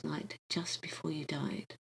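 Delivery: soft hushed whisper. A narrator's reading. Guide revealing he visited the boy before dying, whispered. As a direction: breathe it gently to him. Gentle revelation of his death.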